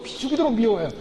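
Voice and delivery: ordinary delivery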